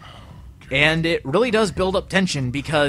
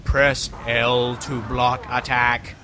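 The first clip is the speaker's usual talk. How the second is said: robotic airlock voice; 5 semitones lower, much slower than usual. Retold or imitated. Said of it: "the airlock had to tell me".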